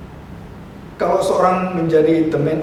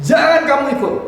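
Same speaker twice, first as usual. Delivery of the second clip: higher-pitched, emphatic order; firm command; 7 semitones higher, 3 dB louder than usual. Retold or imitated. Preacher retelling an angel's order to John, firmly commanding. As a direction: give it as an urgent prohibition. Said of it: "dan diperintahkan".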